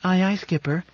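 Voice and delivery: put-on high, timid girl's reply, unsure of herself; a scolded child answering meekly; uncertain little girl. A narrator's reading. Ship answering her captain as an unsure little girl. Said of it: "it said, uncertainly".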